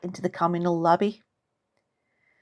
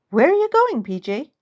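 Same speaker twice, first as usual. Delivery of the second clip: lightly voiced aunt; 6 semitones higher than usual.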